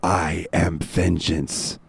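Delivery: slow, dramatic declaration; brooding follower. Retold or imitated. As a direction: intone it grandly, drawing out each word. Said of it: "he, he goes".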